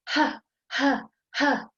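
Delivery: short panting breaths. Shown as a demonstration. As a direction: pant onto your fingers. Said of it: "if you go".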